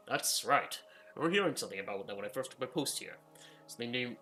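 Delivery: normal talking voice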